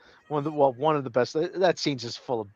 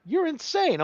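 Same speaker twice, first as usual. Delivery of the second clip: mock disbelief; 14 semitones higher, slower than usual. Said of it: "And she's like".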